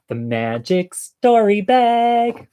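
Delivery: high, slow sing-out announcement; playful announcer. Pretend. Host unveiling the magic story bag, playful announcer. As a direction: draw it out like a magical segment title. Announcing the show's regular segment.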